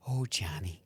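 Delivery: put-on soft, slow Midwestern accent; Sister Terry. Aunt judging nephew's film, whispered Midwest accent. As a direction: breathe it out as a hushed Midwestern aunt. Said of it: "your Sister Terry's Midwest accent".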